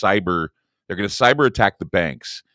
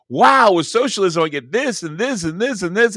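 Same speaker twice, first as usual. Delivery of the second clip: high, loud mock delight; gushing over free handouts, half mocking; mock excited; 11 semitones higher, 4 dB louder than usual. Host mimics a socialist's gushing, mock excited.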